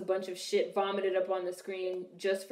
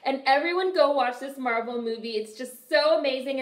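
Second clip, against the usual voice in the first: high, loud, gushing fan voice; gushing Marvel fan; 7 semitones higher, 3 dB louder than usual. Retold or imitated. Mocking Marvel fans who praise every movie, gushing. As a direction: rave about it like a starstruck fan. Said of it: "people would be like".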